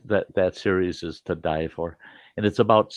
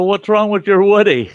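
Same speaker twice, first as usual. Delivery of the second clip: higher and louder, fighting back laughter; loud laughing question; 12 semitones higher, 9 dB louder than usual. Retold or imitated. Grandpa asking the grandson about his toy, loud and high. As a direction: call it out to the kid. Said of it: "And I go".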